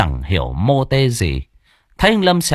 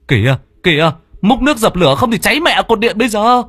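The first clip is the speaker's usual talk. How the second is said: fast, much higher and louder alarm; urgent shout; 11 semitones higher, 4 dB louder, faster than usual. A narrator's reading. Tẩy shouting at everyone to put out the fire, urgent. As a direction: bark orders to save the pole. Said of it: "Nghe tiếng cậu Tẩy hô".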